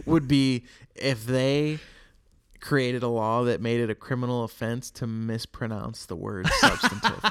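laughing as the joke lands; teasing through laughter. Real, not acted. Co-host teasing host about mispronouncing, laughing. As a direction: hold back laughter to the punchline.

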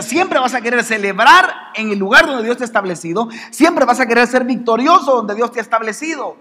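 loud, high, exhorting sermon voice; full-force preaching. Acted. Preacher promising the congregation victory, full-force preaching.

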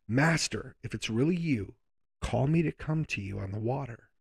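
ordinary talk

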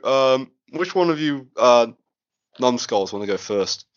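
ordinary talk

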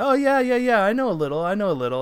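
a hammed-up eager bluff to a celebrity; fake confidence. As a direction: oversell it, bluffing.

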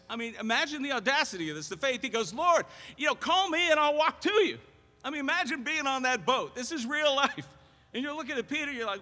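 high-pitched, animated sermon storytelling; excited preaching. Real, not acted. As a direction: tell it with mounting amazement.

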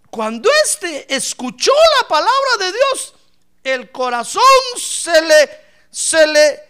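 loud, high preaching cry; sermon at full force; fervent preaching. Acted. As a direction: preach it out to the congregation. Preacher building to emphatic preaching.

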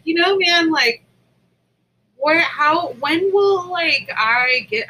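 high, loud, exasperated mock plea; mock whiny lament. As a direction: wail it like you're hard done by. Mock lament about free stuff.

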